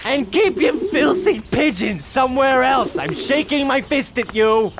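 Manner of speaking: put-on telling off the old man at full voice; yelling at the pigeon man; angry yell. Yells at old man in park.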